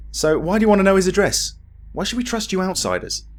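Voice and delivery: lightly voiced distrustful local; suspicious townsman. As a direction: demand to know why. NPC interrogating.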